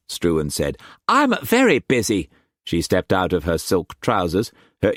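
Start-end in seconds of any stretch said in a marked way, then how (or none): 1.1-2.3 put-on high, loud playful young woman; Mei Mei's voice. A narrator's reading. Mistress teasing Struan in bed, high playful voice. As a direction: tease him in a high bright voice.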